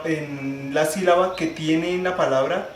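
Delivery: normal talking voice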